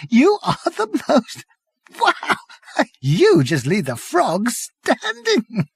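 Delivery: very high, slowing through laughter; high laughing Prack. A narrator's reading. Prack to Arthur, high and helpless with laughter. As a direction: wheeze it out.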